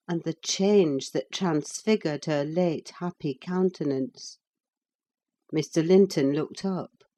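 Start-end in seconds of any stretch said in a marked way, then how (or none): none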